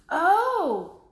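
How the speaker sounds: high, loud drawn-out oh; sudden realization. Acted. Annie realizes Lincoln was Sam: loud high surprised oh. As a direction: it suddenly clicks.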